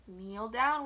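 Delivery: plain reading voice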